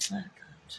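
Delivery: ordinary delivery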